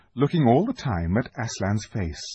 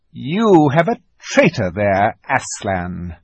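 witch's accusation; 2 semitones higher, 7 dB louder, slower than usual. A narrator's reading. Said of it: "said the witch".

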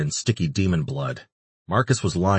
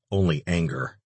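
plain reading voice

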